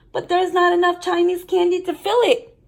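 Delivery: lightly voiced kid protesting; protesting little brother. A narrator's reading. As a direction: argue back with a childish whine. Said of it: "I told her".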